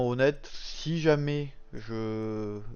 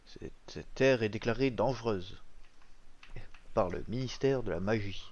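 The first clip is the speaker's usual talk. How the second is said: usual speaking voice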